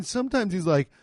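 normal talking voice

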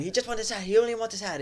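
high-pitched mock yell; imitated screaming.

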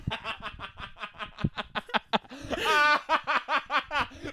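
long, high mock laugh of the stranger in the stall; imitating a coworker's laughing fit; imitated laugh. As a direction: cackle like the guy next door. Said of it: "He's like".

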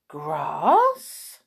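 drawn-out, rising, baffled "Grass?"; puzzled question. Acted. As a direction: ask "Grass?" as if never heard of it. Moo puzzled by farmer's advice.

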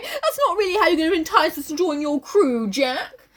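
high, laughing plaintive voice; mock protest. [name] objecting to Jack's recruiting, mock plaintive. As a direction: plead it in a put-on whiny pitch.

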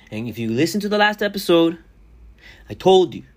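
loud, high, stretched out; excited build. Acted. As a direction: make it sound huge. Building hype over road trip.

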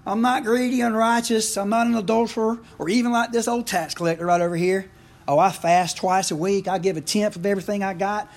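high, loud boastful prayer; a self-satisfied Pharisee showing off to God; smug Pharisee. Retold or imitated. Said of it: "praying like this about himself".

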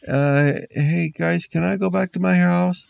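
high, timid man asking to leave; nervous man voice. Acted. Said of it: "kind of said".

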